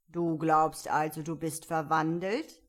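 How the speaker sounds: lightly voiced commanding caterpillar; caterpillar character voice. A narrator's reading. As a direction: question Alice as a stern caterpillar. Caterpillar speaks to Alice in story.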